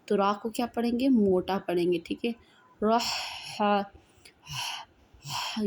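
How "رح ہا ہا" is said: ہا breathed out as an unvoiced, throaty h; teacher isolating the ح sound; ha sound demo. Shown as a demonstration. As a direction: push air from the throat on ہا. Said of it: "یہ sound نکالنی ہے ہا کی".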